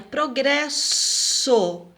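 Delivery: progresso stretched very slowly on the SS; drawn-out example word. Shown as a demonstration. Teacher presenting the first exercise word, drawn out. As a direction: dwell on the S sound in progresso. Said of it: "A primeira palavrinha".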